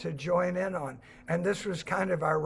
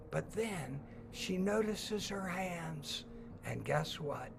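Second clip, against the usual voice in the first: soft, slow reveal; hushed wonder; 10 dB quieter, slower than usual. A narrator's reading. Storyteller revealing the magic ending to the kids, hushed. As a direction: let the ending hush to a secret.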